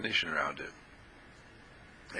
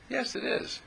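ordinary talk

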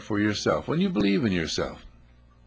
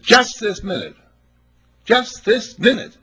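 higher and louder each time, slowing at the end; building emphasis; 9 semitones higher, 5 dB louder than usual. Retold or imitated. Preacher to congregation, repeating the ticket lady with rising emphasis.

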